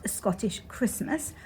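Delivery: ordinary delivery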